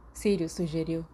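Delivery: ordinary delivery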